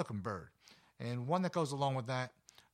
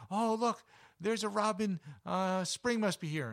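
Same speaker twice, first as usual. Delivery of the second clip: much higher and louder, gushing over a robin; mock excited; 8 semitones higher, 5 dB louder than usual. Park passerby spotting a robin, high eager exclaim. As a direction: play the delighted passerby who spots a robin. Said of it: "I'll hear somebody going".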